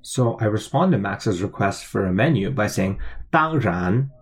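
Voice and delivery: ordinary talk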